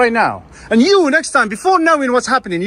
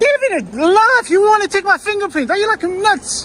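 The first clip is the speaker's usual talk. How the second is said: raised high angry voice; shouting; 9 semitones higher than usual. Real, not acted. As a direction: yell at the officer in disbelief. Shouting at officer after arrest threat.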